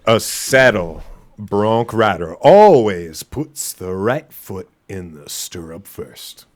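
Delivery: slow, loud cowboy drawl. Acted. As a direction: read it like a rodeo cowboy. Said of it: "put some motherfucking bass in your voice".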